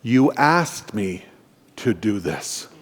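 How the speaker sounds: slow, measured divine reminder with long pauses; grave, unhurried voice from heaven; voice of the Lord. Retold or imitated. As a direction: speak as God, slowly, sinking low and soft at the end. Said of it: "the Lord speaks very loudly, and he says".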